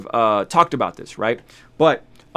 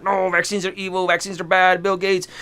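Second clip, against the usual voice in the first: slightly higher, lightly put-on whiny voice; a hint of a ranting skeptic; mock anti-vaxxer; 6 semitones higher than usual. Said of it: "You guys are like".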